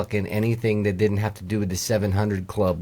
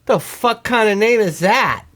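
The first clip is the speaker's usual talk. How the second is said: high, raised-voice disbelief; incredulous outburst; 9 semitones higher, 4 dB louder than usual. Real, not acted. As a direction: blurt it out, baffled and indignant. Baffled outburst at odd name.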